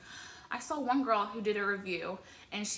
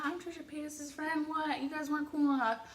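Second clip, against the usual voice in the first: higher-pitched pretend boast; hammed-up bragging in a raised voice; mock bragging; 5 semitones higher, 4 dB quieter than usual. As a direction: show off to the losers, playfully. Imagined bragging about new friendship.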